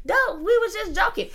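high, frantic family shouting no; panicked yelling. Retold or imitated. Family in the background begging the dad to stop, high panicked cries. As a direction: cry out high to stop the fight. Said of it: "all in the background yelling like".

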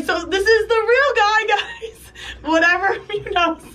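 high, laughing retold line; laughing through it. Friend introducing the real guy, laughing hard. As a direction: crack up while quoting Betsy.

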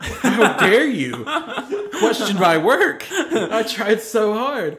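high, slow, laughing mock indignation; mock outrage. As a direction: play it wounded and outraged, laughing. Mock outrage over the bags.

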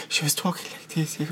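imitating a barely audible whispering actress; mock whisper. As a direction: drop into a hushed, unintelligible whisper. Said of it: "She was whispering".

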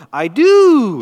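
put-on high, loud toddler voice stretching the O; little girl's eager reply. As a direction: squeal it like a happy three-year-old. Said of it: "with this exact accent on the O".

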